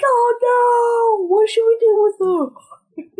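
mock panic. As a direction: squeal it like a cartoon worry. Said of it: "We're like".

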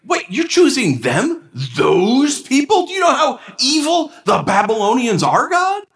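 high, incredulous protest to God; exasperated Habakkuk. Retold or imitated. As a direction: voice a prophet who can't believe it. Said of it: "he's, in a nutshell, saying".

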